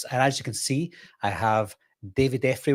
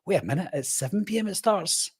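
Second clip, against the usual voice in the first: high, softer puzzled question; mock double-take, pitched up and quieter; retold surprise; 8 semitones higher, 5 dB quieter than usual. Retells surprise at the start time.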